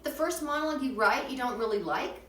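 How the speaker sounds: ordinary talk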